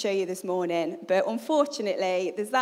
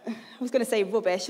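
ordinary delivery

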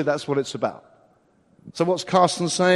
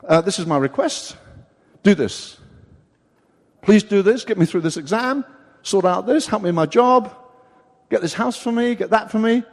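loud, pushy list of orders to God; demanding prayer; 3 semitones higher, 6 dB louder than usual. Retold or imitated. Believer ordering God around, mock pushy loud. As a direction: bark demands at God like errands. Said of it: "we get him out of our pocket".

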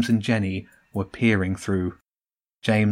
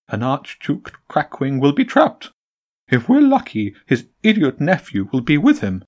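high, jolly pigeon greeting; a sly bird host, all bonhomie; 5 semitones higher, 4 dB louder than usual. A narrator's reading. Pigeon boss greeting the Empress, high and jolly.

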